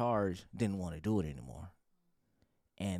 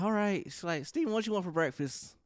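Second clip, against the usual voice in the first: sister voice; 10 semitones higher than usual. Retold or imitated. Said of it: "Kristen was like".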